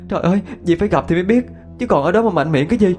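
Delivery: high, fast, indignant retort; snapping angrily. A narrator's reading. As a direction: snap back at a scoffing friend. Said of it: "Nhung gắt lên".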